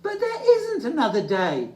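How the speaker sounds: high, loud pleading little bear; Little Bear crying out. Acted. Little Bear pleading with Old Bear, dismayed. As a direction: protest in dismay. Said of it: "cried Little Bear".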